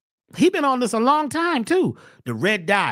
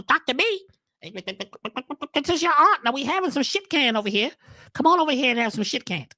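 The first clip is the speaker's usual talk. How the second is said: a higher, lightly voiced aunt calling out; aunt's call; 3 semitones higher than usual. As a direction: call him over like a cheerful aunt. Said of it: "My aunt called me".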